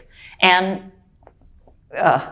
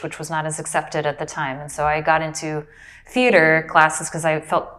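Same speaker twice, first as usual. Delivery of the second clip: ordinary talk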